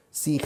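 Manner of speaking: "Sign" rhymes with line, silent g; pronounced sign. Shown as a demonstration. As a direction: say "Sign" as sain, no g sound. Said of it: "se pronuncia sign".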